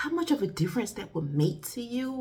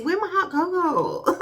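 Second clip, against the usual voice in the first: mock demand; 10 semitones higher, 5 dB louder than usual. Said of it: "I'm like".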